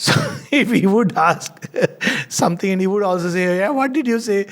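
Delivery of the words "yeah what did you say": high-pitched hard-of-hearing query, no real shout; raised-pitch mock calling to a deaf friend; imitated loud asking. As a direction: mimic someone loudly asking for a repeat.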